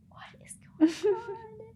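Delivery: high breathy whisper of disbelief. Retold or imitated. Her, baffled by her date, shocked whisper. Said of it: "And I'm like".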